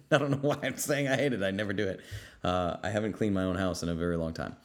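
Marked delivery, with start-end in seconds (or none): none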